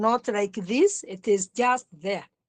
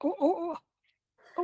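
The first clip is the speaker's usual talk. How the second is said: mock distressed client; 7 semitones higher, 4 dB quieter than usual. Teacher playing a suffering client for the student, mock anguished oh. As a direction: pretend anguished oh, pitched higher. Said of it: "I will be the client".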